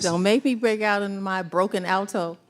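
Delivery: ordinary talk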